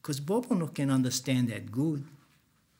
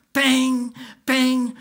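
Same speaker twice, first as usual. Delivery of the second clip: 1 banging sounds; 8 semitones higher, 6 dB louder than usual. Retold or imitated. Bobo hitting the van.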